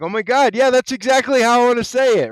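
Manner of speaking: high, loud burst of recognition; excited realization. Retold or imitated. Telling viewers his reaction to the speech, excited realization. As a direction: exclaim it like a thrilled discovery.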